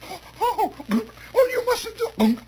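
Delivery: very high, flustered Hungarian lady; nervous Hungarian lady. Acted. Lady begs boy to stop popping stomach, high alarm. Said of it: "a very nervous Hungarian lady".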